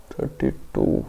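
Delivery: soft whisper to yourself; whispered aside. Real, not acted. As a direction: whisper the number under your breath. Muttering the question number aside.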